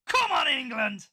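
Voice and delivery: high, loud, drawn-out cheer; rallying shout. Real, not acted. Host signing off the podcast, rallying shout. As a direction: belt out a long football cheer.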